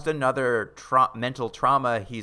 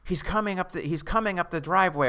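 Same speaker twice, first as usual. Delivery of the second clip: higher-pitched, lightly panicked chant; freaking-out kid; 6 semitones higher than usual. Retold or imitated. Retelling the boy's warning chant, mock freaking out. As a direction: mildly imitate a frightened child's chanting. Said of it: "the kid starts freaking out".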